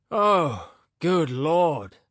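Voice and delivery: high, loud doleful groan of dismay; doleful exclamation. A narrator's reading. Weary professor groaning at the man's complaints, doleful. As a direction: moan it out in weary despair. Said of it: "exclaimed dolefully".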